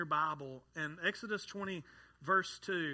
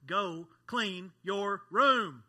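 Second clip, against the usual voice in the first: slow, drawn-out, louder parent command; stern dad order; 2 semitones higher, 7 dB louder, much slower than usual. Acted. Dad voicing his order to his children, slow and stern. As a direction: lay down the law to the kids.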